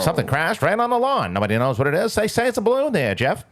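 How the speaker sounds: high, sing-song newsreel voice; old radio announcer. Retold or imitated. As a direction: report it like a 1940s radio bulletin. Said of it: "I love the old-time radio voice".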